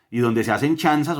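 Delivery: usual speaking voice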